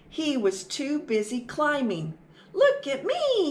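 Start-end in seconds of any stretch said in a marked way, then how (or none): 2.5-3.5 much higher, louder call to mom; excited cry. Acted. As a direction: shout it out proudly to mom. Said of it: "he cried".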